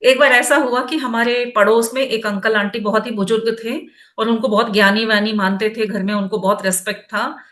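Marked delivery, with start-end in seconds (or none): none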